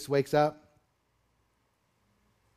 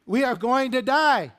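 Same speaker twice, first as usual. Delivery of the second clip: high, loud cry of alarm; frantic, high-pitched shout for help; panicked disciples; 10 semitones higher, 7 dB louder than usual. Acted. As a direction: wail to Jesus that death is coming. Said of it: "here's a modern translation for you".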